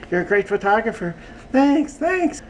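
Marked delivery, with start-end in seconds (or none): 1.5-2.4 high puppet voice; squeaky little character voice; Audrey. Acted. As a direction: chirp thanks in falsetto. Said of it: "Here's my Audrey".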